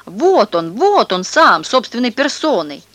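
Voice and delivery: put-on excited boy shouting. A narrator's reading. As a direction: point and shout him out. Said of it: "да как закричит".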